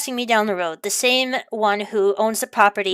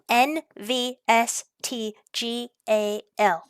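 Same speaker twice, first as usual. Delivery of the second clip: slow spelling; 5 dB quieter, much slower than usual. Shown as a demonstration. Vlogger spells a license plate to viewers, slowly. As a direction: spell each letter slowly. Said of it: "spelling out a plate".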